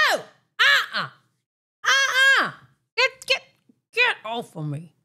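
mock panicked squeal; high, alarmed refusal. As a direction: push the grabbing hands away. A stranger grabs her from behind.

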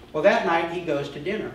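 usual speaking voice

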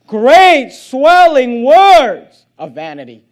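loud, slow, weighty verse reading; booming scripture. Acted. Preacher booming the verse to his congregation, loud and slow. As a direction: thunder out each word of the verse.

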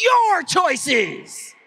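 Your choices said loud and high, Your hit hard. Shown as a demonstration. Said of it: "that one's always louder".

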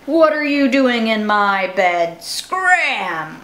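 loud, growling dog voice; a big dog bellowing to get his bed back; big growly voice. Acted. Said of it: "big, deep, growly voice said".

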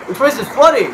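high, loud cry of alarm; alarmed shout. Real, not acted. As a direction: yell a warning in panic. Alarm at flood in game.

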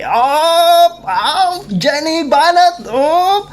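high, loud cheering yelps; cheering shout. Real, not acted. Cheering Jenny on at the karaoke, loud whoops. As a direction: whoop her on from the crowd.

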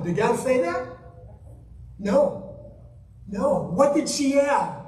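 usual speaking voice

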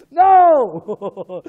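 loud, high shout amid laughter. Acted. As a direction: yell it through the laughing. Laughing, loud mock refusal.

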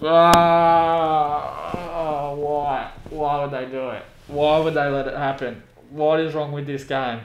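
drawn-out despairing cry at a goal conceded; agonised groan into pleading despair; real anguished wail. Real, not acted. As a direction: wail in disbelief, stretching the words out. Opponents score late against his team.

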